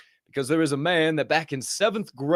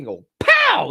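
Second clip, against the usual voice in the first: mock pow; 7 dB louder than usual. Imitating coffee machine burst.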